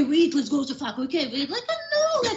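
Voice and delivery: high, laughing through words. Real, not acted. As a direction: laugh while pushing the point. Laughing while explaining.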